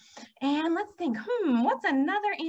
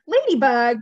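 loud, mildly grumpy bear; a hint of grumbling at being woken; storybook grumble; grumbled; 10 dB louder than usual. A narrator's reading. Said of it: "grumbled Bear".

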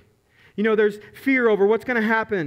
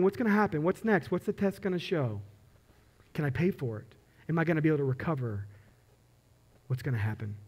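softer, sinking toward very low and quiet; hushed worry; 4 semitones lower, 7 dB quieter than usual. Acted. Patient waiting on test results, acted in hushed worry. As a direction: voice anxious private questions, trailing down.

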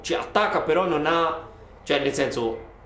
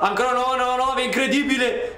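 rising, higher play-by-play; excited commentary; 6 semitones higher than usual. Real, not acted. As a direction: call the attack urgently. Dangerous attack, live commentary.

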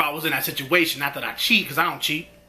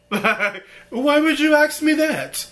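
laughing; 7 semitones higher, much slower than usual. Real, not acted. Laughing at her answer.

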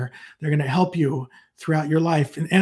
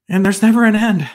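higher and louder, mock-overwhelmed dismay at never an end; a preacher hamming up his own mock despair to the congregation; 6 semitones higher, 7 dB louder than usual. Stress on never in mock dismay. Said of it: "I remember hearing that last, uh, sentence there and going".

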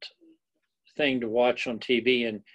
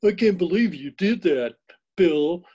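high, loud Californian soap-opera voice; hammed-up Californian accent, pitched up and louder; exaggerated Californian; 7 semitones higher, 7 dB louder than usual. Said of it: "exaggerations in the way they talk".

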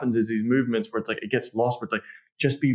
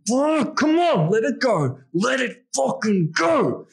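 much higher, louder, slow and insistent; urging; 12 semitones higher, 3 dB louder, much slower than usual. Shown as a demonstration. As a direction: push her on, all in. Urging partner during sex.

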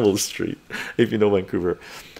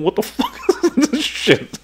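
shocked adults; 13 semitones higher than usual. Retold or imitated. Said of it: "They're all looking at us going".

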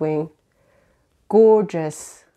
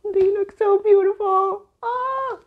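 much higher, gushing squeal; real giddy delight; 12 semitones higher than usual. Real, not acted. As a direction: squeal with joy. Squealing over sprayed edges.

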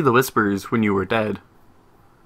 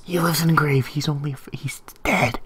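hushed aside; 2 semitones higher, 4 dB quieter than usual. Pretend. Player whispering a theory about Santa, hushed. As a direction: whisper it like a secret.

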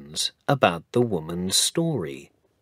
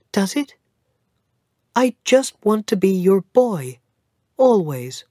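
put-on high, louder child's voice, eager; hopeful little boy; 12 semitones higher, 4 dB louder than usual. Cedric begging to stay his grandfather's boy, eager. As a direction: plead happily like a small boy.

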